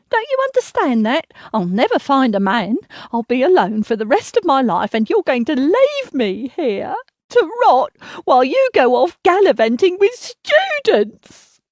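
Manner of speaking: high, loud, near-hysterical wail; building into a loud, high plea; rising outburst. Acted. Mother's self-pitying outburst.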